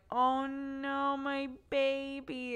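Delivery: high, slow dismayed cry; aching over a sick baby; high drawn-out oh no of a worried mother; retold worry. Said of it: "and I was like".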